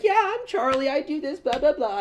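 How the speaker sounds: high, bright mock introduction voice; chipper self-intro. Comedian mimicking himself introducing himself to class, eager. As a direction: squeak out a cheery hello.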